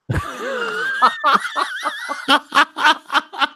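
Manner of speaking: long, high burst of laughter; big laughing fit. Real, not acted. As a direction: crack up helplessly at your joke. Cracks up at the Bill Murray pick.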